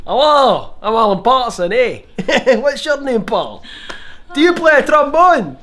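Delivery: put-on squeaky old Edinburgh man greeting Paul; Alan Patterson.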